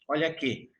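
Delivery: usual speaking voice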